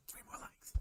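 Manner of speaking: barely audible whispered repeat. Real, not acted. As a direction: whisper it very faintly. Hushed repeat of his appeal.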